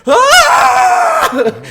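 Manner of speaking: loud shriek through laughter; laughing mock shout. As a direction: ham up the scream. Acting out the noises.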